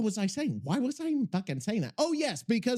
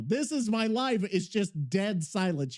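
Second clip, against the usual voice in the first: ordinary talk, nothing marked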